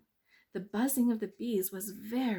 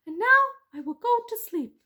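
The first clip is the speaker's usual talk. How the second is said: high, loud tricky mouse; 11 dB louder than usual. Acted. Mouse tricking the bees, high and sly.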